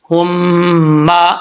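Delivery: ہم with a held nasal hum; nasal ghunna. Shown as a demonstration. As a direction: hold the م of ہم nasal for one alif. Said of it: "ایک الف کی مقدار غنہ کرتے ہوئے".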